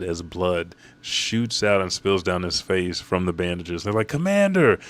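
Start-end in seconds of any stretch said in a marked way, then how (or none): 4.1-4.8 high, loud mock cry; acted alarmed call. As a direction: call out urgently, short. Soldiers call to wounded commander.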